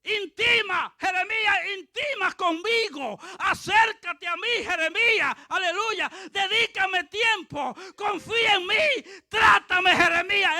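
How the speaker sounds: loud, high, urgent preacher voicing God; God's insistent plea. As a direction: press the prophet with one command after another. Acts God pleading with Jeremiah.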